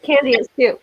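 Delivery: ordinary delivery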